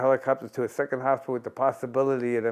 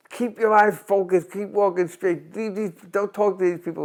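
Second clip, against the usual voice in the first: trainer's instructions; 8 semitones higher, 5 dB louder than usual. Retold or imitated. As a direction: drill it into the young fighter. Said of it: "would always tell me".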